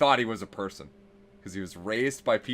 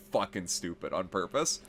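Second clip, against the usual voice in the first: ordinary delivery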